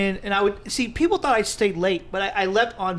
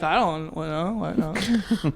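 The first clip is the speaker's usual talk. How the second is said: playing dumb; 3 semitones lower, 3 dB quieter than usual. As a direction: drag it out like you saw nothing. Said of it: "I'd be like".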